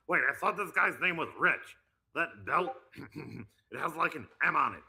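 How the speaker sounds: high, loud, confused cartoon teen; Beavis and Butthead. Confused about the host's name, loud Beavis impression. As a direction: puzzle it out as a dim cartoon slacker. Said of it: "do it how Beavis and Butthead would".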